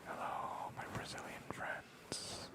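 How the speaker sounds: slow, soft whispered greeting; ASMR whisper greeting. Real, not acted. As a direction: draw it out in a hush.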